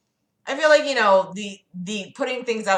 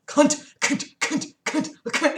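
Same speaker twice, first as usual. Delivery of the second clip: higher-pitched sneeze-like bursts of one word; like sneezing; 7 semitones higher, slower than usual. Retold or imitated. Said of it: "it was like he was sneezing".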